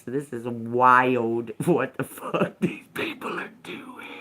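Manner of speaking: slow, whispered aside with laughs; laughing whisper. Real, not acted. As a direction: whisper it in amazement. Whispers to viewers.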